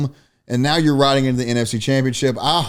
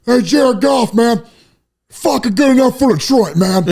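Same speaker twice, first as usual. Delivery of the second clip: much higher, louder, hyped-up coach growl; Dan Campbell impression; 8 semitones higher, 5 dB louder than usual. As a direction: pump up the room like a fired-up football coach. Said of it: "And he's just sitting there".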